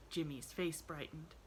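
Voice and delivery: ordinary delivery, nothing marked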